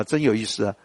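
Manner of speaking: ordinary talk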